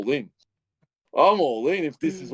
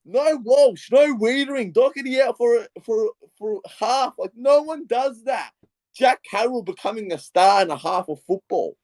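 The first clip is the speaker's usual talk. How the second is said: much higher, carried away with disbelief; overwhelmed gushing; 11 semitones higher than usual. Real, not acted. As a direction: gush like you can't believe it. Gushing over an unlikely comeback win.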